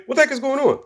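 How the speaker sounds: high, confused squeak; baffled villain. Retold or imitated. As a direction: Guinea Pig losing control, high baffled squeak. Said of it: "So he's like".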